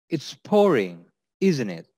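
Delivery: "isn't it" going gently down; falling tag intonation. Shown as a demonstration. Teacher models opening small talk about rain, falling tag. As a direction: drop the tone on "isn't it". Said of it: "intonation is slightly going down at the end".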